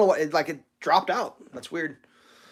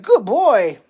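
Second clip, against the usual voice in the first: retold praise; 7 semitones higher, 8 dB louder than usual. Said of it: "I was like".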